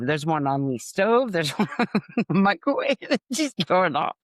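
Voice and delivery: real laughter breaking through high-pitched words; cracking up. Real, not acted. Storyteller quoting grandma's clock retort, breaking up laughing. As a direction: try to finish while laughing hard.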